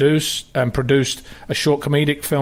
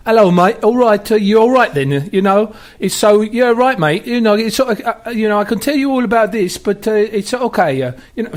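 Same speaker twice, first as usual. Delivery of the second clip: put-on higher-pitched mum mixing English and Italian accents; matey English slang in an Italian-tinged accent, pitched up; 7 semitones higher than usual. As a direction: imitate an Italian mother's chatty, high English-Italian accent. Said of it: "her accent is actually English Italian".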